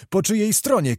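high-pitched insistent repeat; escalated urgent demand; shouted question. A narrator's reading. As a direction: cry the question out, pitch jumping up. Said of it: "krzyczał Zagłoba".

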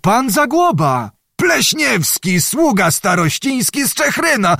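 high-pitched cry of recognition; cried out. A narrator's reading. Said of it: "gdy krzyknął".

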